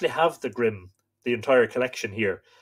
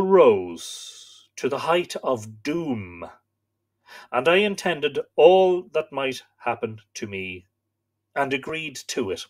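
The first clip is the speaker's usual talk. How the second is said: slow, solemn storytelling read; story narration; 4 dB louder, slower than usual. A narrator's reading. Host reading myth to live viewers, solemn narration. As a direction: read the old myth aloud, grave and steady. Reads Irish myth aloud.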